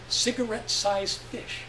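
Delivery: plain reading voice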